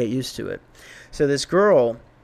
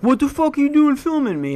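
much higher, mock furious snap; hammed-up blowup at the guy filming; imagined angry outburst; 11 semitones higher than usual. Yelling at the man filming him, mock furious. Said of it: "have a video of me being like".

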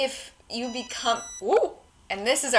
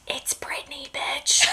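whispered in a spooky hiss; ghostly whisper; 4 semitones higher, slower than usual. Pretend. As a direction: whisper it like a ghost behind someone. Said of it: "whispering behind you".

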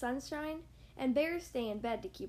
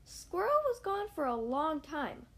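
usual speaking voice

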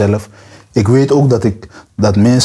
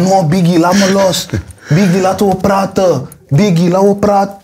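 imitating a high, scared begging yell; mock panicked pleading; 8 semitones higher than usual. Mocking the rival begging [name] to let go, high panicked pleading. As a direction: ham up a beaten man begging to be let go. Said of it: "hoe hij ging schreeuwen".

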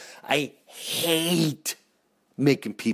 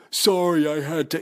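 mock apology; 4 semitones higher, 4 dB louder than usual. Late person apologizing.

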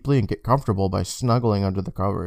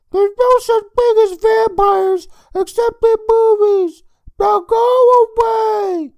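put-on whiny kid shout; 7 dB louder, slower than usual. Boy under covers refusing.

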